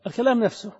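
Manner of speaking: usual speaking voice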